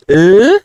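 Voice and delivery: "eh" said loud and high, tongue out; a bright, forward "eh"; clear L sound. Shown as a demonstration. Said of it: "No decimos como". ("eh" con la lengua hacia afuera)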